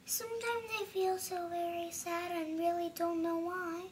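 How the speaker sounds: soft, sad and mournful. Acted. Child reading the sad page in a sad voice. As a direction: read it softly, as if close to tears. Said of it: "a very funny voice for each tone".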